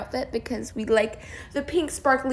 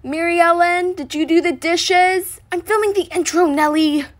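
raised voice calling across the house; 6 semitones higher, 6 dB louder than usual. Real, not acted. Youtuber calling to her sister mid-intro, loud. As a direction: call out to a sibling in another room.

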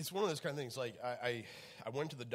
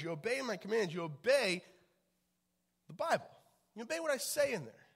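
preaching at full force; 7 semitones higher, 5 dB louder than usual. Acted. Pastor voicing God to the church, building high. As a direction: voice God's demand, rising to a plea. Voicing God's command, rising.

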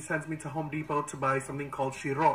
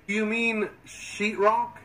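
slow puzzled clerk; 5 semitones higher, much slower than usual. Retold or imitated. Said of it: "he's like".

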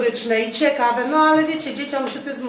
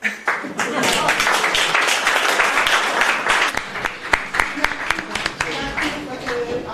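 long unvoiced laugh; breathy laugh; 3 semitones higher, 4 dB louder than usual. Real, not acted. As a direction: let out a long airy laugh. Laughs after finishing the poem.

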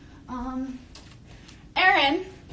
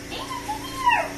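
high excited pet voice, fading softer; high singsong puppy call; 8 semitones higher, 8 dB quieter than usual. Real, not acted. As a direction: call the dog in a much higher, cooing voice. Calling and cooing at puppy.

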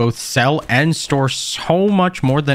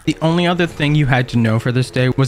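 louder, steady recap narration; voiceover narration; 6 dB louder than usual. A narrator's reading. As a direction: narrate the day like a video recap.

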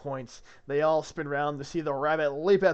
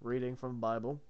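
ordinary delivery, nothing marked

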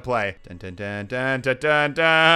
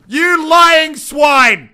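mock outrage; 10 semitones higher, 8 dB louder, much slower than usual. Mock outrage at cheating friend.

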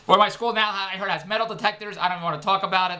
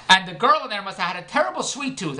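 ordinary talk

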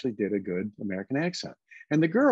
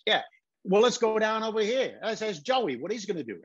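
pitched-up New York accent; 9 semitones higher than usual. Shown as a demonstration. Guys and Dolls gamblers talking to each other, New York accent. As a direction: do it as authentic New York talk. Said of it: "instead of, uh, you know, when they're talking".

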